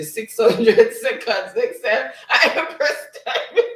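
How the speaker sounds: reading the message through real laughter; words breaking up into giggles; laughing. Real, not acted. Streamer reads a funny chat message aloud, laughing.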